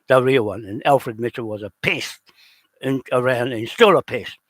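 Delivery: loud, heated tirade against a rival; furious and loud, spitting out each complaint; angry rant. Real, not acted. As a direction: raise your voice and let the anger spill out.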